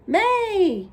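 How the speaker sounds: high, loud excited shout out the window. A narrator's reading. Girl arriving shouts through the window, excited. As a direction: shout it out the car window, thrilled. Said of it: "She shouted through the open window".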